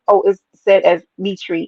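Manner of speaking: usual speaking voice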